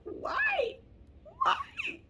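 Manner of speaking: very high, whiny cry of why; hammed-up whimpering why at herself; mock wail. Real, not acted. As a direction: wail it like a cartoon sob. Mock wail after ranting.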